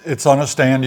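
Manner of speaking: usual speaking voice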